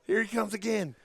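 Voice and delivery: high-pitched play-acted warning; comic lookout cry; mock alarm. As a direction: call it out in pretend dread. Joins the mock panicked center.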